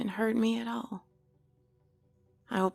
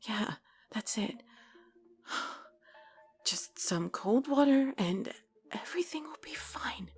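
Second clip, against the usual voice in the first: self-soothing whisper. Acted. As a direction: whisper it to calm yourself. Talking herself down, whispered.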